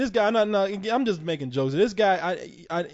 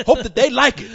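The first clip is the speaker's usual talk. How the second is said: mocking eager sap; 4 semitones higher, 8 dB louder than usual. Mocking the convention's invitees to his cohost as a gushing fanboy, loud and laughing. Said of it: "the fuck kind of fucking sap".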